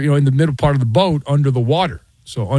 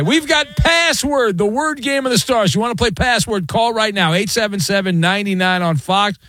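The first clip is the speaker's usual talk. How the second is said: high, announcer-style plug; high pitched radio promo voice, slowing at the number; promo read; 5 semitones higher than usual. Acted. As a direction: sell the game show like an ad. Host does a promo read.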